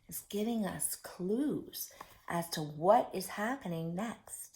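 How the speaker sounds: soft whispered aside. Real, not acted. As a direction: lean in and whisper the clue. Whispering a secret clue.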